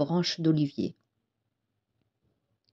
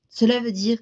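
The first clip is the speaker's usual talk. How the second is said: plain reading voice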